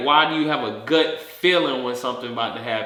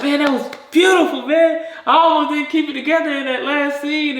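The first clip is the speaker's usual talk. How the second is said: high, loud mock admiration; overjoyed fan voice, pitched way up; gushing praise; 14 semitones higher, 4 dB louder than usual. Relatives congratulating the actor on the death scene, gushing praise.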